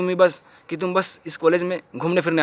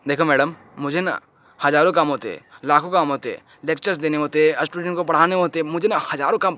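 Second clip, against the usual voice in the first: usual speaking voice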